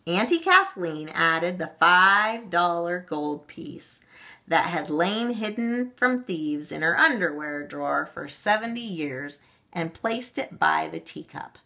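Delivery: usual speaking voice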